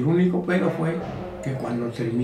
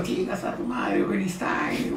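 mock crowd yell; 2 semitones higher, 8 dB quieter, slower than usual. Recalling the crowd's insults at the trainer, mock yell kept quiet. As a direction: mimic distant hecklers' yells under your breath. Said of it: "pero los gritos".

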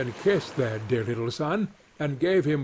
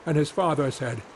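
ordinary delivery

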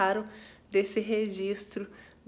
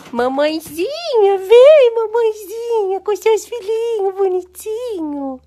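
much higher and louder, sing-song pet call; calling the cat; 11 semitones higher, 13 dB louder than usual. Real, not acted. Owner calling the mother cat, loud sing-song coo.

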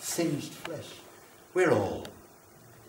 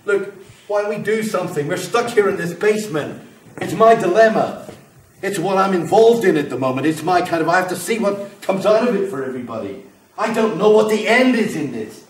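louder and higher, rushing and worked up; agitated outburst; 5 semitones higher, 7 dB louder than usual. Acted. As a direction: get wound up and push on. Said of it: "I'm getting agitated again".

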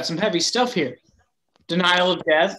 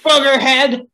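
high, loud yelled insult; shouting from another room; 8 semitones higher, 8 dB louder, slower than usual. Acted. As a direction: shriek the insult back across the house. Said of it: "from the other room comes a loud barrage of profanity".

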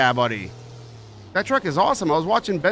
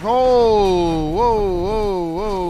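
drawn-out, higher, sliding whoas; startled string of long whoas; alarmed whoa; 6 semitones higher, 5 dB louder, much slower than usual. Real, not acted. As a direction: ride out a near miss with long whoas. Truck nearly lost, sudden alarm.

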